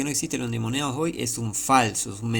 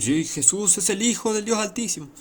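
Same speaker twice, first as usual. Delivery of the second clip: high mock proclamation; acted high-pitched profession of faith; crying out; 9 semitones higher than usual. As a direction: declare it high, imitating false believers. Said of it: "pero claman decir".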